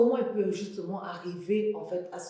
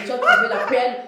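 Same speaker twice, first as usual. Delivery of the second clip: loud, amused congratulation; laughing exclamation; 4 semitones higher, 12 dB louder than usual. Real, not acted. Host congratulating guest on her husband, laughing and loud.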